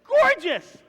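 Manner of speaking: high, loud gushing exclamation; excited. Real, not acted. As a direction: rave about her. Raving about the woman.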